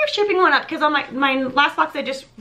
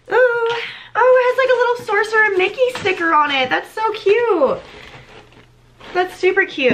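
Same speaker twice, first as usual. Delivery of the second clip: real squeal-edged delight, pitched well up; 7 semitones higher than usual. Real, not acted. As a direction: coo over a cute little surprise. Delighted at finding a sticker.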